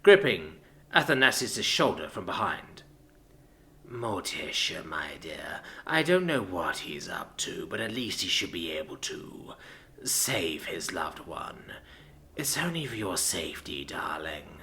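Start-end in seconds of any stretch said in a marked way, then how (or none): 3.9-14.6 low, slow, hushed tenderness; caring. A narrator's reading. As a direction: soothe her gently, half whispered. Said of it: "in a surprisingly caring way".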